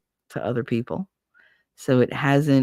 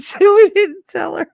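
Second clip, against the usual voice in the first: high, laughing imitation of the nun; mock nun voice broken by laughter; laughing quote; 8 dB louder than usual. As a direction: giggle it out way up high. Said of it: "She said".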